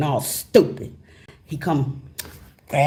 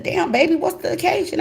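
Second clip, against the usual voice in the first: high, delighted husband voice; a happy man walking in on a fancy dinner; husband, pleased; 11 semitones higher than usual. Retold or imitated. Husband impressed by the dinner, high and delighted.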